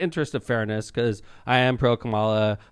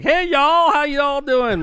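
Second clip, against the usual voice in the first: hammed-up Southern greeting, much higher and louder; put-on Southern accent; 6 dB louder, much slower than usual. Mayor pretending to campaign down south, mock Southern accent. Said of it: "my accent sounds funny".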